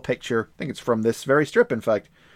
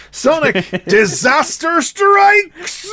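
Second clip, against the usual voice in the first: higher, louder, stretched slow trailer voice; dramatic title announcement; 8 dB louder, much slower than usual. Acted. Announcing the next story to listeners, slow dramatic trailer voice. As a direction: boom out the title like a movie trailer. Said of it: "dramatic title announcement".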